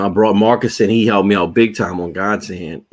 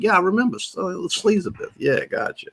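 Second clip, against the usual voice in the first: lightly voiced, higher and softer, half out of it; mock the hazy memory coming back; a hint of a semi-conscious guy remembering; dazed recollection; 9 semitones higher, 5 dB quieter than usual. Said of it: "It's like".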